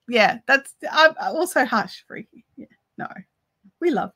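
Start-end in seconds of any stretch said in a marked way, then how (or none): 2.1-3.1 barely voiced hushed aside; whispered aside. Real, not acted. Host hushes to a whisper aside to viewers. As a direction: drop to a whisper, keeping it private.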